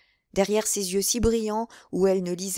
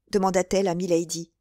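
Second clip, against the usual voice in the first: plain reading voice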